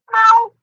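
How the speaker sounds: mock meow. Playing cat meowing.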